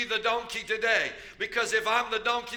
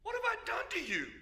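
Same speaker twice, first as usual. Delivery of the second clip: high, soft pleading donkey; donkey's voice; 10 semitones higher, 6 dB quieter, faster than usual. Acted. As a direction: squeak it out as the hurt donkey. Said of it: "and she said to Balaam".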